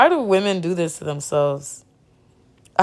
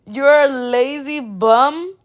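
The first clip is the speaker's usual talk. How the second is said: mocking disbelief; 6 semitones higher, slower than usual. Real, not acted.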